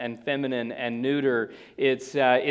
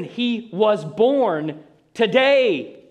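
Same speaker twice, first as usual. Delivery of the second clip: very slow, higher and louder, weight on "today"; slow emphatic stress; 6 semitones higher, 6 dB louder, much slower than usual. Shown as a demonstration. Preacher urging listeners, slow weighty stress on today. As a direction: draw out "today" with great weight. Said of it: "But listen".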